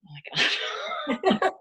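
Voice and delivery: fed-up groan of disbelief; exasperated. Retold or imitated. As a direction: roll your eyes and drag it out. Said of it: "And I'm like".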